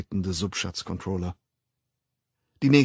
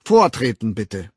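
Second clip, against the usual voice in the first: loud, energetic orders; 4 semitones higher, 7 dB louder than usual. A narrator's reading. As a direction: call the crowd to order, rising to a high peak. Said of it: "erklärte er energisch". (energisch kommandierend)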